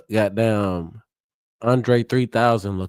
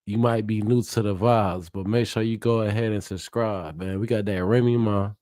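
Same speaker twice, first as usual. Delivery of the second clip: ordinary talk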